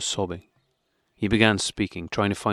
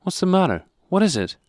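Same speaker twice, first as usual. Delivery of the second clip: higher, sudden worried questions; alarmed; 11 semitones higher, 4 dB louder than usual. A narrator's reading. Wife asking husband about the letter, alarmed. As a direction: catch the change in his face and ask.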